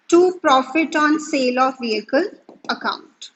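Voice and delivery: very slow dictation of a journal entry with long pauses; slow classroom dictation; dictating entry. Acted. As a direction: spell out the entry so students can write it down.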